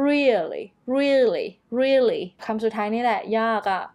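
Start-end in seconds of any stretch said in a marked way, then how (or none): 0.1-2.2 slow, repeated "really", each punched on REE; first-syllable stress. Shown as a demonstration. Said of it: "เน้นเสียงหนักที่พยางค์แรก".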